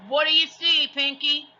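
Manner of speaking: high, loud pirate shout up the ladder. A narrator's reading. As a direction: call it up at the treehouse. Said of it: "shouted Captain Bones".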